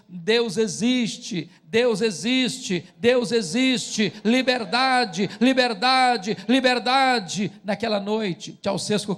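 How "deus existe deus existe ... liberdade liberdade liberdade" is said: slow, drawn-out chant, rising in pitch and volume; retold crowd shout. Crowd in the capital crying out for freedom, retold as a slow, mounting shout. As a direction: proclaim each cry like a crowd, building to loud. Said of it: "gritando a plenos pulmões".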